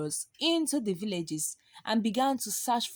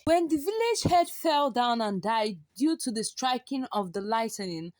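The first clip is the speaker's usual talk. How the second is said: ordinary delivery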